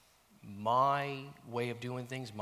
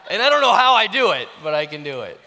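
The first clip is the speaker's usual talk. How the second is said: much higher and louder through real laughter; laughing; 7 semitones higher, 16 dB louder, faster than usual. Real, not acted. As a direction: spill it out laughing. Laughing confession.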